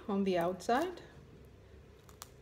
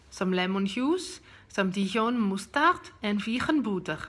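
put-on Dutch accent with throaty G sounds; [name]; 4 dB louder than usual. Dutch friend teaches vegan hollandaise, strong Dutch accent. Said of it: "tagged me to do a Dutch accent".

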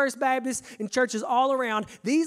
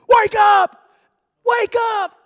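much higher, much louder bellow of wake up; a camp pastor roaring at his dozing lifeguard; yelling at me; 13 semitones higher, 14 dB louder, slower than usual. Retold or imitated. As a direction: bark him awake like an intense boss. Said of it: "he was yelling at me".

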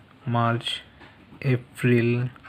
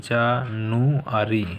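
"January" slowly, each syllable held apart; broken into syllables; much slower than usual. Shown as a demonstration. Teacher recapping the split month for pupils, "January" syllable by syllable. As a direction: split "January" into slow, separate chunks. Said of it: "সিলেবিল ভাগ করে দিলাম".

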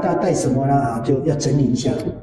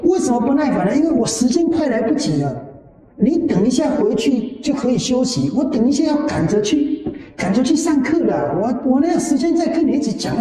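raised higher, retelling an impatient protest; mildly indignant self-quote, pitched up; exasperated retort; 7 semitones higher than usual. Retold or imitated. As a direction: argue back, rushed and fed up. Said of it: "后来我才讲".

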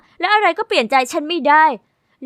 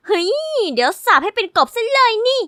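put-on higher little-girl threat; childish threat; 6 semitones higher than usual. Little sister threatening the bully to turn him into a frog, pitched high. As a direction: threaten like a small magician.

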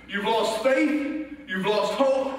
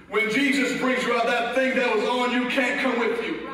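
usual speaking voice

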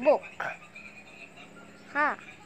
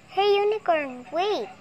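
higher, louder call to stop someone; 7 semitones higher, 7 dB louder than usual. Pretend. Child calling the unicorn toy not to sleep, loud call. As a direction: shout after her before she leaves.

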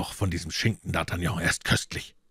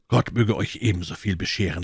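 ordinary delivery

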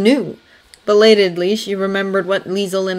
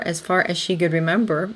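ordinary delivery, nothing marked

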